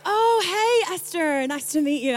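high, loud "oh", delighted greeting; suddenly understand and greet in an American accent; friendly American recognition. Retold or imitated. American finally understands her name, delighted greeting. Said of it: "And they'll be like".

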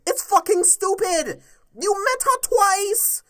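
furious podcast rant. Real, not acted. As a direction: hammer each word in disbelief. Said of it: "this is why I'm ranting".

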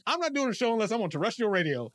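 put-on lightly lampoon his old excuse; mock stubborn self. Mocking his past excuse about radio in a put-on voice.

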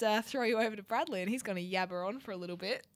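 ordinary talk

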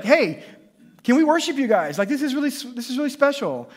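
high, loud, gushing enthusiasm; acted-out eager plea; excited Peter. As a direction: pitch it up like a thrilled fan. Acting out Peter's excited request.

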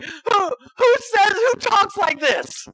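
high, slow, laughing squeal of mock outrage; laughing disbelief. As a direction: cry out through laughter at the absurdity. Mocking jargon-heavy theologians.

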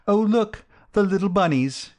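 put-on high-pitched girlish delight; gushing young lady. A narrator's reading. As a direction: coo over the bunnies, high and gushing. Gushing girl spots rabbits in story.

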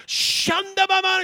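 high, loud burst of tongues; imitating a woman bursting into tongues. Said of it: "she'd go".

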